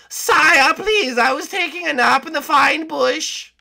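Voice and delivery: high, loud whiny servant voice; whiny guard. Acted. Woken by his captain, a high loud whiny guard. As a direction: whine up high at being woken.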